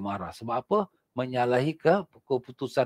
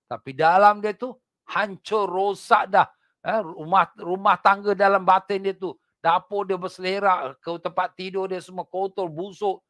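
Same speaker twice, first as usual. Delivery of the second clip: fiery preaching; 7 semitones higher, 5 dB louder than usual. Acted. Preacher warning the congregation about hypocrites, fiery. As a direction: drive the warning home from the pulpit.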